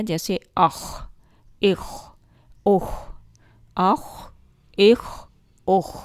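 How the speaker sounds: اخ repeated with a throat rasp; heavy throaty kh drill. Shown as a demonstration. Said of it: "اس کی تھوڑی سی practice کرتے ہیں". (گلے کی خراش کے ساتھ اخ کی مشق)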